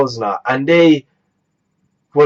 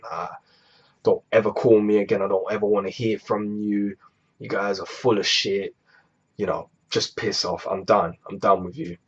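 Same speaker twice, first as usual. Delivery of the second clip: softer, restrained angry telling-off; furious but keeping it down for the sleeping aunt; angry but hushed; 6 semitones lower, 8 dB quieter than usual. Retold or imitated. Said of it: "but stay calm at the same time".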